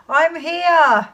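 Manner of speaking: high, loud answering call from far away; crying out. Acted. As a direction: holler back so you can be found. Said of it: "the voice cried out".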